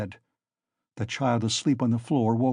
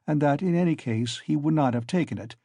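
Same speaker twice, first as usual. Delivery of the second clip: usual speaking voice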